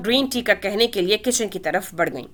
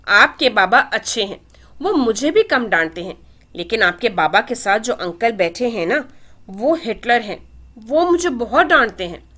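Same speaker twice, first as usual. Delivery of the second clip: ordinary delivery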